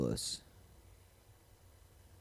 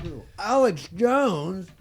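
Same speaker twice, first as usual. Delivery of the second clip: high, loud, drawn-out disbelief; incredulous; 11 semitones higher, 7 dB louder, much slower than usual. Real, not acted. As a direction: stretch it out in disbelief. Incredulous question.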